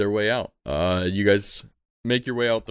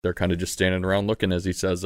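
ordinary talk

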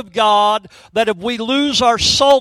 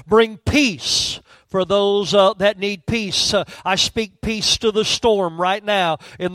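low, fervent pastoral prayer; pulpit prayer; 3 semitones lower, 3 dB quieter than usual. Acted. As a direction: pray over the hurting people in the pews. Pastor prays over the congregation.

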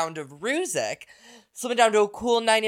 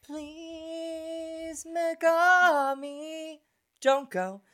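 imitating [name]; 11 semitones higher, much slower than usual. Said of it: "Rue doesn't say".

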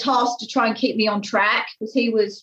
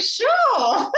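mock chirpy sure; 12 semitones higher than usual. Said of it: "I was like".

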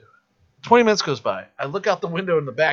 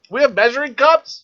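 high, louder puzzled question; mock bewilderment; 11 semitones higher, 6 dB louder than usual. Cook puzzling over fractions: high mock confusion. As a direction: play lost in the kitchen, high.

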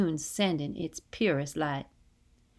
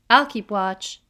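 raised voice, higher and louder; louder, lifted call; 4 semitones higher, 8 dB louder than usual. A narrator's reading. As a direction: call it after people walking away. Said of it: "Brooklyn calls after us".

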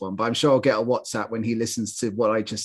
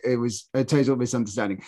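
ordinary talk

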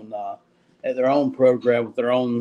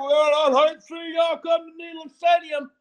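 mocking fan voice. Mocking quote of Tennessee fans.

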